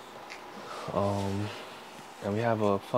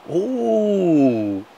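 teasing ooh; 7 semitones higher, 8 dB louder than usual. Real, not acted. Reacts to own roast joke.

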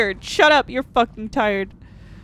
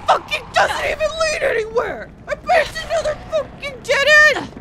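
high, ragged yelling rant; screaming in frustration; furious rant; 12 semitones higher than usual. Real, not acted. Raging at enemies in game.

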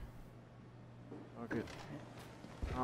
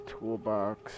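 ordinary talk

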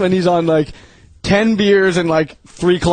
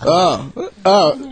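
imitated bad acting; 3 semitones lower, much slower than usual. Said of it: "then when he gets run over".

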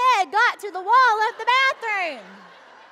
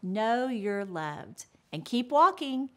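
low, measured announcement voice; show promo read; 11 semitones lower, 4 dB quieter than usual. Acted. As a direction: deliver a polished sign-off, pitched well below stage talk. Recorded promo and sign-off to viewers.

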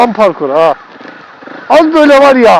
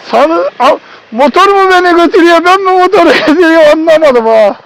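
laughing; 7 semitones higher than usual. Real, not acted. Exhausted, laughing at himself.

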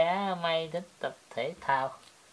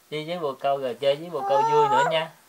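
usual speaking voice

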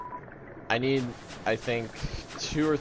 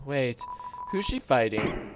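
usual speaking voice

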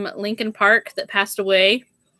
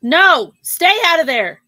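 scolding; 5 semitones higher, 4 dB louder than usual. Real, not acted. Scolding the child off-camera.

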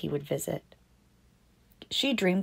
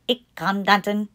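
Dutch toy voice. Acted. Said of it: "It could speak in Dutch".

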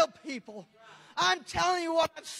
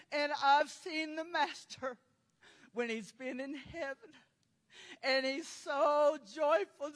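ordinary talk, nothing marked

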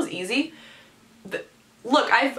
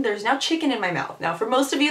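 ordinary talk

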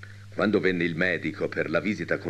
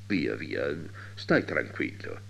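lower, softer, slowing to soothe; calm him down gently, voice dropped; sincere reassurance; 7 semitones lower, 5 dB quieter, slower than usual. A narrator's reading. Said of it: "si calmò alquanto per l'accento di sincerità". (rassicurazione sincera, bassa e pacata)

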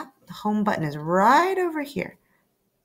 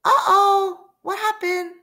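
much higher, startled; surprised uh-oh; 10 semitones higher, 4 dB louder than usual. Acted. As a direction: sense something's gone wrong. Sudden trouble in story.

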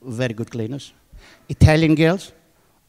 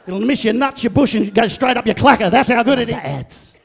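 normal talking voice

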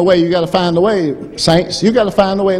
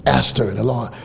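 normal talking voice

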